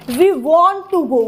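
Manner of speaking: slow, with "want" and "go" stressed; stress on content words. Shown as a demonstration. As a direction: punch "want" and "go", leave "to" unstressed. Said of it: "want और go पे stress है". ("want" और "go" पर ज़ोर, धीरे)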